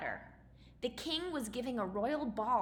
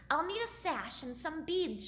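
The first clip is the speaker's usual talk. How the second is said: lightly voiced; 6 semitones higher than usual. A narrator's reading. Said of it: "she said".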